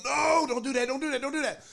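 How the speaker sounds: high, loud, repeated plea; mock panicked restraint; retold frantic shout. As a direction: act out a coach urgently stopping a fight. Coach restraining him in retold fight.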